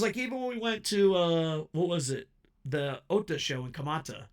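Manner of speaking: ordinary talk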